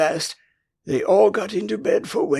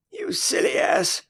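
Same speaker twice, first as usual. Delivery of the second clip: very high, breathy fairy outburst; in a passion. A narrator's reading. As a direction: fling it out in a tiny rage. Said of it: "cried Tinker Bell in a passion".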